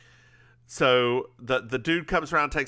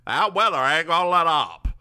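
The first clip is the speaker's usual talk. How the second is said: put-on louder gruff old man warning; lightly voiced grumpy old-timer; 5 semitones higher, 4 dB louder than usual. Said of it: "He's a bit one note".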